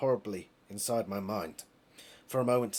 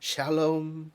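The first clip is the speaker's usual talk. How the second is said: sitcom dad; 5 semitones higher than usual. Retold or imitated. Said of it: "It's more of a British thing".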